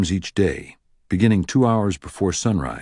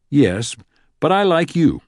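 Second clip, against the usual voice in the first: sarcastic; 7 semitones higher, 3 dB louder than usual. Acted. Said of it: "Sykes replied sarcastically".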